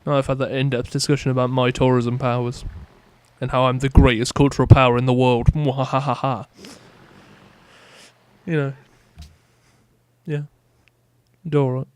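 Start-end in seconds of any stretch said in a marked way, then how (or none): 5.5-6.5 hammed-up villain cackle; evil laugh. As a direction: gloat like a cartoon villain. Bragging about cultural power.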